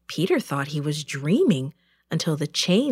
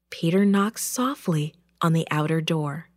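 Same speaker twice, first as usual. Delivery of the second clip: ordinary delivery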